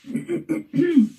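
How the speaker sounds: rough throat clear. Real, not acted. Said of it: "patient with my croaky voice".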